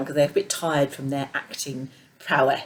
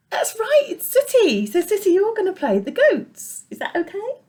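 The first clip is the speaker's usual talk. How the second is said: high puppet-show voice; 5 dB louder than usual. Acted. Puppet show, addressing Sooty puppet.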